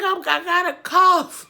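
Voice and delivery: laughing, high-pitched. Retold or imitated. Retold telling doctor about cough.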